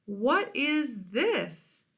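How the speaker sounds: slow, high, puzzled character voice; the vulture discovering the embarrassing photo, puzzled and slow. Acted. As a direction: drag the question out in a different voice.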